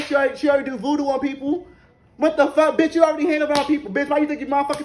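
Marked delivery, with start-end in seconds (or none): none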